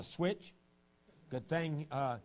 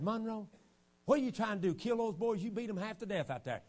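pleading mother; 7 semitones higher than usual. Retold or imitated. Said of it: "she said".

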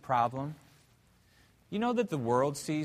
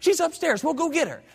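put-on much higher, excited child voice; eager little girls; 12 semitones higher, 5 dB louder than usual. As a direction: squeal it like a girl begging for the bus. Little girls answering the preacher.

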